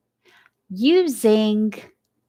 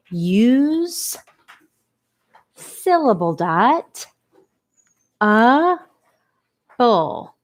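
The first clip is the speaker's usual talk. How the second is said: syllable by syllable; 3 semitones higher, much slower than usual. Shown as a demonstration. Sounding out word by syllables.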